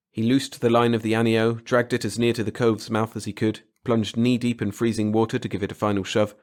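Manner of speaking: ordinary delivery, nothing marked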